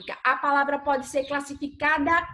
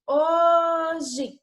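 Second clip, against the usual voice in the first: "Hoje" stretched slowly, weight on HO; HO-je, long first syllable; stressed syllable demo; 3 semitones higher than usual. Shown as a demonstration. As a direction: sound out "Hoje" syllable by syllable, first one strongest. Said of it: "pronunciamos com mais intensidade".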